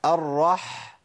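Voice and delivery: «الرح» stopped on a breathy mid-throat ḥ; audible ḥ huskiness. Shown as a demonstration. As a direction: hold the final ḥ of «الرح» as a long husky breath. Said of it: "سمع صوت الحاء؟". («الرح» مع إطالة بحة الحاء)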